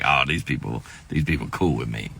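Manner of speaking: low, soft aside, a touch of relief; mock relieved aside. Comic to himself in church, low muttered relief. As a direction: mutter it low like a private joke. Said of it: "And I was like".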